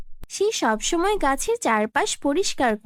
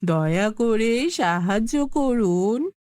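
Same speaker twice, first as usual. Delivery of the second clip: put-on old woman pleading; 6 semitones lower, slower than usual. Old woman begging the tree.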